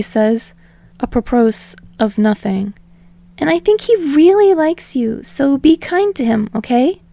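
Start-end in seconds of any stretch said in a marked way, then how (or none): 3.4-7.0 rambling. Acted. Deku rambling to Bakugo on the phone, higher and eager. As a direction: babble on nervously without a breath.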